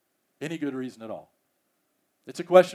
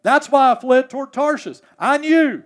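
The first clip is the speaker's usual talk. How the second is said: louder, higher, exasperated complaint; a mild mock rant of Jonah; griping Jonah; 5 semitones higher, 7 dB louder than usual. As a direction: whine at God like an aggrieved prophet. Preacher re-voices Jonah's gripe.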